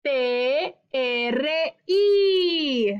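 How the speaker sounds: "T R I" spelled letter by letter, very slow; spelled slowly. Shown as a demonstration. Teacher spelling the syllable for young pupils, slowly. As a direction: spell out "T R I" carefully. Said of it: "¿Cómo se escribe tri?".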